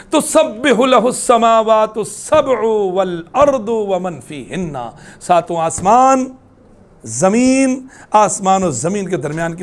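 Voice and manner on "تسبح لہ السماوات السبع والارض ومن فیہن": very slow, drawn-out chanted Arabic; Quranic recitation. Acted. Preacher quoting God's word to the congregation, slow chant. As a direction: intone each word of the verse reverently. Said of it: "اللہ کا فرمان".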